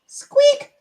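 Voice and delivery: a very high, tiny squeak. Shown as a demonstration. Reading chat's request, then a very high squeak. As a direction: squeak like a little toy on request. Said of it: "so we can hear him squeak".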